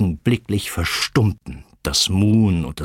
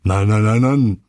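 loud, rapid-fire doggy barks; forceful guard-dog yapping; barking sheepdog; 4 dB louder than usual. A narrator's reading. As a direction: bark the word over and over. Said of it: "bellte Hasso der Hütehund".

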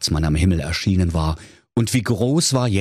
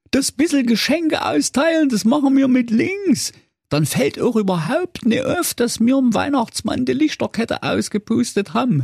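scheming wolf voice. A narrator's reading. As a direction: pitch way up, pitch the scheme. Wolf explains plan.

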